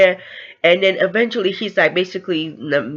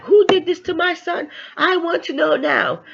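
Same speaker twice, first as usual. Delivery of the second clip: flipping out; 12 semitones higher than usual. Retold or imitated. Mother demanding who hurt her son, flipping out. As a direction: act out a frantic mother demanding answers, voice way up. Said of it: "She was flipping".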